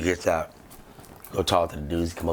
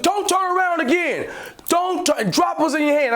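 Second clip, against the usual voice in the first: police shouting orders; 14 semitones higher than usual. Retold or imitated. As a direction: yell like an armed officer.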